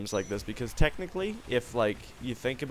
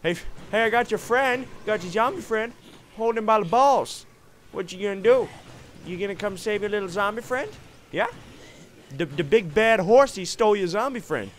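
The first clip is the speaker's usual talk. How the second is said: high, loud mocking taunt at the enemy; 9 semitones higher, 7 dB louder than usual. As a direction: jeer at him like a playground bully. Taunting enemy while holding zombie.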